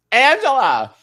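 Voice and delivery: high, loud call of a name. Retold or imitated. Testing if it's her at the bar, calling out loudly. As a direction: call across a bar to catch her attention.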